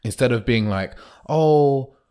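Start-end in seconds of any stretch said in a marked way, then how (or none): 1.3-2.0 higher, slow timid asking for a date; a hint of a nervous suitor; meek asking. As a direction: ask as if scared of a no. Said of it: "That's the worst line a man can say".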